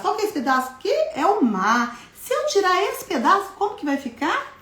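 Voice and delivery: put-on high, bright voice for little kids; sing-song teacher voice. Shown as a demonstration. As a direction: talk like a preschool teacher playing a syllable game. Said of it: "teacher voice for children".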